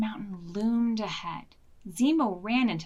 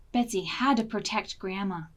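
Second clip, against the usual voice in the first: usual speaking voice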